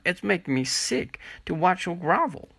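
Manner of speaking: sneering, scornful jab; mocking disgust. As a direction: sneer it out as a taunt. Miranda mocks Shelley.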